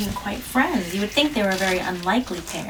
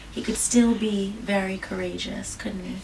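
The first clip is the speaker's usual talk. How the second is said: usual speaking voice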